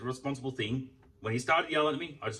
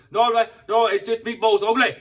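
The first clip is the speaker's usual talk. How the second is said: pitched way up and loud, a whiny mock of the worker; high, loud sneering imitation of a rude counter guy; mocking the shop worker; 12 semitones higher, 13 dB louder than usual. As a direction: mimic the shop worker snapping back, hammed-up. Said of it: "he started talking to me like".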